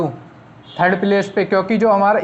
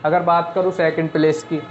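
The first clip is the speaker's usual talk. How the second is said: usual speaking voice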